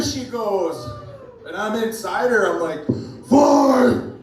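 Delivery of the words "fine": much higher, louder fed-up yell; mock yell. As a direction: snap it out before the punch. Fed-up reply before hitting.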